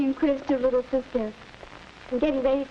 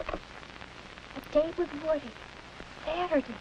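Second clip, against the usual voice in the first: soft, slow and much quieter, savoring it; whisper-soft daydream to herself; dreamy; 8 dB quieter, slower than usual. Acted. Girl alone after the call.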